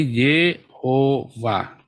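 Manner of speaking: Yehovah split slowly into 'Ye, ho, va'; syllable-by-syllable reading. Shown as a demonstration. Teacher reads letter by letter, demonstrating slow Yehovah. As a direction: sound out Yehovah one syllable at a time. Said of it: "karena ini di bawahnya huruf waw".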